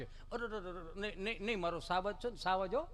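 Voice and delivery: soft doting parent; acted tender reassurance; mock soothing. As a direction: hush and comfort a fallen child. Father comforts fallen son, acted.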